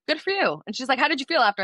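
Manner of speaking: high, bright praise; therapist cheering. Retold or imitated. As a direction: cheer her on like a proud therapist. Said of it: "and she was like".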